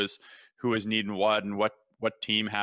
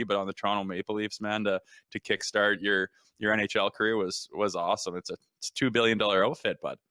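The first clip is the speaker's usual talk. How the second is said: ordinary delivery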